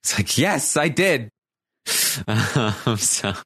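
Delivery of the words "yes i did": imitated upset reply. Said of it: "he's upset and he's crying today".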